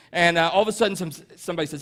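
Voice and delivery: usual speaking voice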